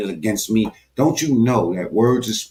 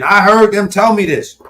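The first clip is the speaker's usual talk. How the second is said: loud, higher angry ranting; 5 semitones higher, 4 dB louder than usual. Real, not acted. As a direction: rail at the heckler. Angry rant at heckler.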